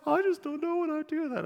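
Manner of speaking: mock tearful plea. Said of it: "look at me with big tears in their eyes".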